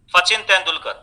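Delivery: सचिन said with f for s, as "Fachin"; lisping s as f. Retold or imitated. Speech-impaired boy answering therapist, सचिन with f for s. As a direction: swap the s in सचिन for an f. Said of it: "ये सकार को फकार बोलता है".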